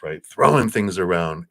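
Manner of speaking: normal talking voice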